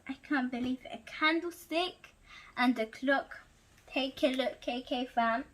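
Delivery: plain reading voice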